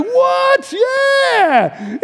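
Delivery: very high delighted cry; high amazed squeal. Acted. Visitor recalling the trolley, high squealing delight. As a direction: squeal with joy. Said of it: "I'm like".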